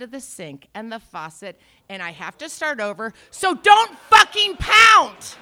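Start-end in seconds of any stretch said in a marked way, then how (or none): 3.3-5.4 slow, loud, high yell; furious slow shout; shouted rant. Retold or imitated. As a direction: bellow it word by word. Yelling at door pounder.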